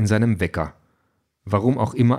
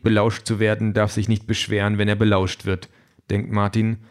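usual speaking voice

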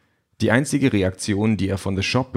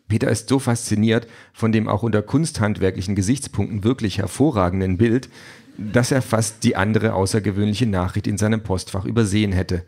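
ordinary delivery

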